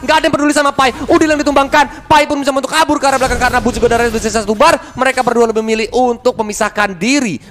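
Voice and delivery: high, loud hype play-by-play; esports caster. Acted. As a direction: call the teamfight at full excitement. Excited play-by-play during teamfight.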